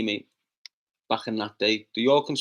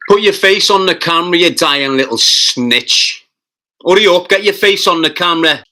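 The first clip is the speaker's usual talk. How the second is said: loud furious yelling at a troll; angry shout; 3 semitones higher, 12 dB louder than usual. Real, not acted. Streamer yelling at a hidden troll to show his face, loud and furious.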